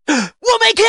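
high shouted meme cry. Acted. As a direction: yell it like the meme. Meme shout about missing key.

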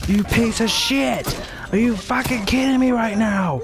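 high, loud screaming tirade; furious rage scream. Real, not acted. Raging gamer screaming insults at the player who stole his kill. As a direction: explode at the enemy in real fury.

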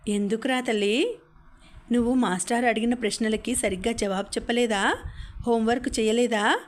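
ordinary delivery

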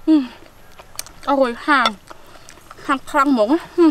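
words between chewing; talking while chewing. Real, not acted. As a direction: mouth partly full. Seen 1: Lips pressed and working between words; garlic eaten just before.

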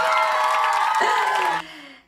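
loud shouted laughter. Real, not acted. After the English punchline, loud laughter. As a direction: burst out laughing loudly.

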